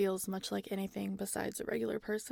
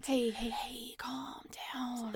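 soft, breathy hush; soothing; 5 dB quieter, much slower than usual. Acted. Clone calming the overexcited scientist, soothing. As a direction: gently talk them down, voice dropping away.

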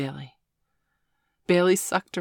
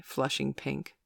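usual speaking voice

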